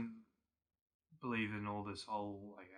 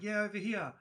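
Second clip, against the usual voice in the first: higher, mock luring call; 7 semitones higher, 4 dB louder than usual. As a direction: pretend to be someone calling them closer. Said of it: "they call out and pretend to".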